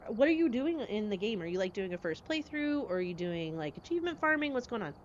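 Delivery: usual speaking voice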